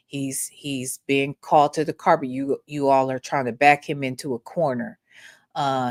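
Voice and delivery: ordinary talk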